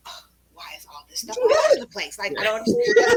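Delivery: high, fast, judgy voice; mock a fussy critic tutting at clutter; critical organizer. Organizer imitating a critical client-shamer, high and fast. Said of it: "more of a critic".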